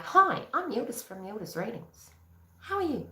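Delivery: soft greeting, sliding up high; mock sweet greeting. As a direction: introduce yourself softly, pitch climbing on the question. Acting out her groggy self-introduction.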